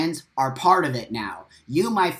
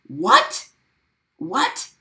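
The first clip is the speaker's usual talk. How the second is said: a sudden very high, loud "What?"; shocked what; 11 semitones higher, 4 dB louder than usual. Retold or imitated. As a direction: retell the call as if stunned all over again. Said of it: "And I said".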